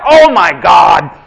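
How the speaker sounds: loud, slow, disgusted shout. Retold or imitated. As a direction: yell it like a dad who's just been pooped on. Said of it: "he's like".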